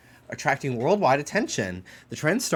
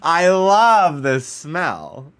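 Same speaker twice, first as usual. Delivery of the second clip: louder, higher, drawn-out delight; 7 semitones higher, 10 dB louder, much slower than usual. Real, not acted. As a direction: belt out the love of the smell. Savouring a smell in game.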